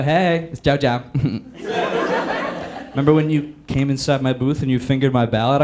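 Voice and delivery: lightly voiced sleazy old charmer; creepy Joe. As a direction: Biden flirting by email, low and sleazy. Biden's email voiced.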